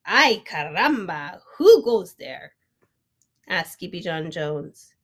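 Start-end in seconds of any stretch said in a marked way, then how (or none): 0.0-2.5 big startled call; hammed-up alarm; loud character call. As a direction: shout the challenge into the dust. Character voice in read story.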